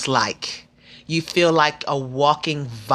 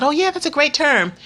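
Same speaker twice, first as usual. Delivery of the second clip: quoting the nurses; 11 semitones higher than usual. Retold or imitated. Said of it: "They said".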